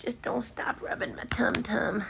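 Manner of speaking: whispered dog voice. Pretend. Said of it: "He says".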